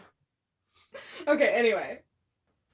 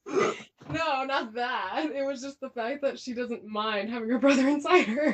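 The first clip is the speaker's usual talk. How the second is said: laughing; 4 semitones higher than usual. Real, not acted. Laughing through words.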